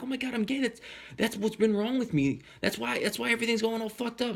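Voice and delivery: very high, retold frantic epiphany; mock freak-out in a high voice; crazy moment. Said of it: "I have this crazy moment".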